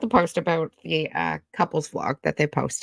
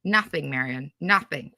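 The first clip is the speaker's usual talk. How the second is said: ordinary talk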